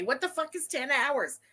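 ordinary delivery

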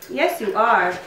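ordinary talk

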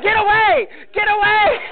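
high, loud panicked yell. Retold or imitated. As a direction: shoo it away in a shrill panic. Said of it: "she's like".

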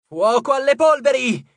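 high, loud triumphant shout. Acted. Leo firing the ship's engines, triumphant shout. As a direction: fire the engines with a yell. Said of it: "gridò Leo".

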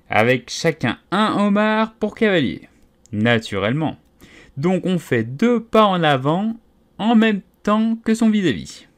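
high, eager, animated telling; lifted pitch, gleeful instructions; excited explaining. Acted. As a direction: rattle on with rising enthusiasm. Creatures excitedly explain the dance.

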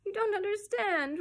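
put-on high, loud child's outburst; furious roar. A narrator's reading. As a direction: storm at a grown-up in a tantrum. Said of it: "roared John furiously".

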